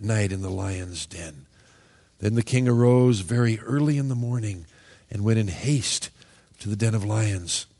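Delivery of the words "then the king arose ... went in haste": usual speaking voice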